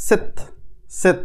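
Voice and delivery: সেত with a soft স at the start, like the ছ in ছয়; সেত spoken clearly, one syllable at a time; German Z sound. Shown as a demonstration. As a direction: say সেত slowly, starting with a light স. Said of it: "ছয়ের মতো একটু উচ্চারণটা আসে".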